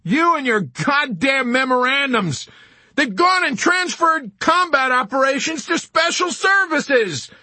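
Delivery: high, loud furious shrieking; enraged, panicked yelling; shrieking with rage and panic. A narrator's reading. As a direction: scream abuse down the phone. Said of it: "shrieking with rage and panic".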